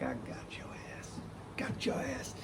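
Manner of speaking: soft, voiceless victory whisper; whispered gloat. Retold or imitated. Storyteller gloating at his beaten buddy, whispering. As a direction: savour the win under your breath. Quiet gloating after winning the exchange.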